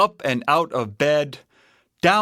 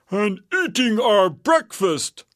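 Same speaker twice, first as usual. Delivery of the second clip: raised, indignant bear voice; Papa Bear; 7 semitones higher than usual. A narrator's reading. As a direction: answer as an exasperated papa bear. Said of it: "asked Papa Bear".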